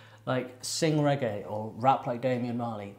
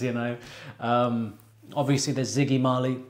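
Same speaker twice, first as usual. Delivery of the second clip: normal talking voice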